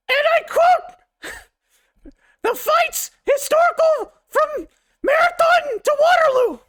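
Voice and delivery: high, loud croaking cartoon toad. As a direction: belt it out as a silly shouting toad. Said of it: "I am going to read this in the Toad voice".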